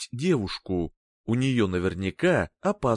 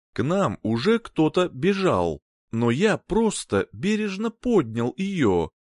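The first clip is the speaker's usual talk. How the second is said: ordinary delivery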